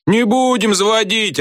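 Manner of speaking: very high-pitched defiant yell; yelled chorus. A narrator's reading. Back-row pupils refuse the teacher, yelling. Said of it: "орали с задних парт".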